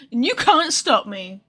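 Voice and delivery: usual speaking voice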